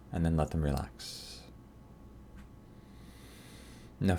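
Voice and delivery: calm, unhurried meditation guide with long pauses; guided meditation. Acted. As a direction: talk them gently through relaxing each part of the face. Leads a guided head scan.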